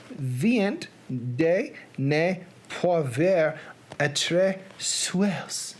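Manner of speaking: put-on slow Southern-accented French. Reader tackling Poe's French quotation, Southern accent. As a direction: sound out the French slowly like a Southerner. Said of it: "Reading French in a Southern accent".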